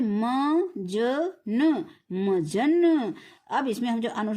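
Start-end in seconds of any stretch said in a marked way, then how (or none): 0.0-3.1 slow spelling demo. Shown as a demonstration. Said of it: "ये क्या है?".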